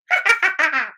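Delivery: creepy cackling hyena laugh; snarling with hyena giggles. Shown as a demonstration. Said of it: "What would your hyena laugh sound like?".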